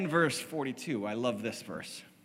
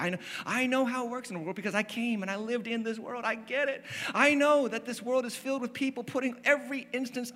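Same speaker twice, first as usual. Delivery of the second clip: rising passion; 9 semitones higher than usual. Acted. Preacher rises in pitch voicing Jesus.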